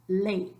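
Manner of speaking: LEY in one beat, vowels merged; one-syllable ley. Shown as a demonstration. Teacher demonstrating ley en una sílaba, merged into one syllable. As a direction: glide E into I so ley is a single syllable. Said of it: "in una sílaba".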